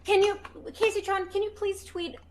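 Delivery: put-on high pleading baby voice. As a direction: beg like a little kid. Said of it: "we're going back to baby voice".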